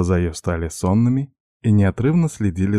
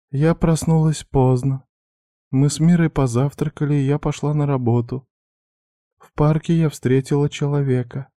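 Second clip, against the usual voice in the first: put-on higher, timid young woman; young girl voice; 7 semitones higher than usual. A narrator's reading. Girl's voice again.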